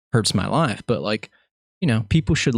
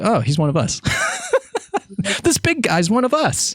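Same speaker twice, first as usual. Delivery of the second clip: put-on kids approving; 10 semitones higher than usual. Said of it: "they're like".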